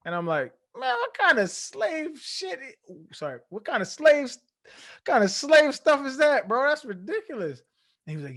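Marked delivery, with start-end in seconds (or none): 0.8-7.6 high, loud incredulous protest; outraged disbelief. Retold or imitated. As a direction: scoff at the rule as absurd. He reacts to having to say sir.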